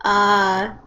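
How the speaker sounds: ordinary delivery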